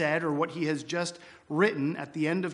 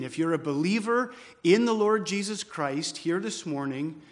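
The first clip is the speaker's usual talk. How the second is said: ordinary talk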